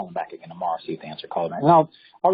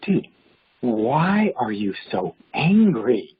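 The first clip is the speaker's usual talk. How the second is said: slow, drawn-out question; slow, emphatic retold plea; exasperated retort; 4 dB louder, much slower than usual. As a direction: stretch each word at the angry man. Exasperated retort to screaming man.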